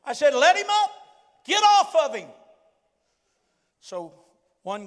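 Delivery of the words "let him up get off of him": high, loud, insistent command; retold forceful order. Preacher retells ordering students off the man, forceful shout. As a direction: shout it like breaking up a pile-on.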